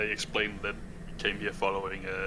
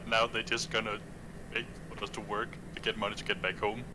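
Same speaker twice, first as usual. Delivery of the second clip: ordinary talk, nothing marked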